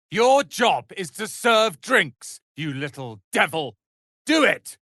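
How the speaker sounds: ordering the server about; angry order. Acted. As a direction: boss them around, then snap.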